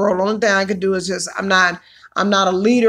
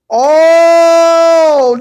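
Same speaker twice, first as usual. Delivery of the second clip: long, loud, high drawn-out oh; a big delighted oh; real stretched surprise; surprised oh; 7 semitones higher, 9 dB louder than usual. Real, not acted. Reacting to crock pot chili.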